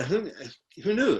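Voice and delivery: normal talking voice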